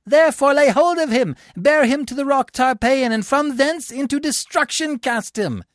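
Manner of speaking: high, loud, theatrical condemnation; proclaiming a sentence. A narrator's reading. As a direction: condemn a man before the people. Tribune pronounces death sentence.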